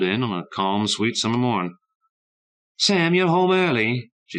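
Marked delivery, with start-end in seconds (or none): 2.8-4.1 surprised wife. A narrator's reading.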